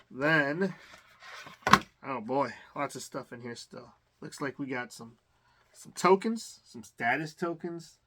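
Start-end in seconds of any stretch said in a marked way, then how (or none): none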